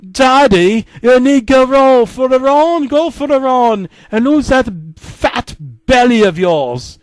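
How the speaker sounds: put-on high, loud little-girl yell at Daddy. Peppa yelling at Daddy to go for a run. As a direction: shout it up at him, high and bossy. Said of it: "came in and yelled".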